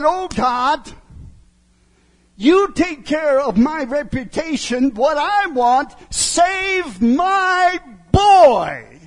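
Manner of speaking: pleading cry that builds to a slow shout; plea rising into a drawn-out loud shout; desperate prayer. Retold or imitated. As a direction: cry out to God as a broken father. Man's prayer of brokenness retold.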